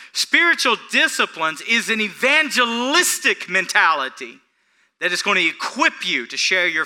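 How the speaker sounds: loud, high, emphatic sermon voice; forceful preaching. Real, not acted. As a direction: drive each point home from the pulpit.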